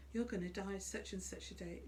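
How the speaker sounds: normal talking voice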